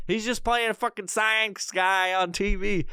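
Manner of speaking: ordinary talk, nothing marked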